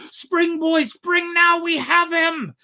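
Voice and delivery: high, loud urging cry; urgent shout. A narrator's reading. Harpooner urging his boy to spring, high loud shout. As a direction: drive the boy on at full voice.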